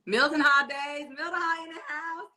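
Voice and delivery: high, rising hype call; hype shout-out. Real, not acted. Shouting out fellow Mildenhall viewers, high rising hype. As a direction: call it out like a party host.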